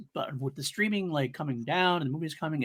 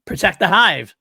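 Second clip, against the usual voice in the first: louder and higher, hammed-up catchphrase; playful movie-line shout; mock battle cry; 6 semitones higher, 10 dB louder, slower than usual. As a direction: belt it out like a mock rallying cry. Repeats the catchphrase more loudly.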